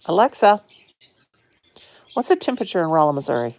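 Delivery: loud call to the speaker device; loud voice command to the smart speaker; calling Alexa. Real, not acted. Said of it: "Let me ask Alexa again".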